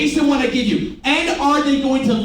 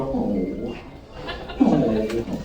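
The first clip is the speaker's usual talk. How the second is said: low, sinking "oh" of fake pity; mock sympathy; 9 semitones lower, 4 dB quieter than usual. Shown as a demonstration. Trainer leading the room in pitying a customer, mockingly. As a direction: coo in pretend sympathy. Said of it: "See everyone go".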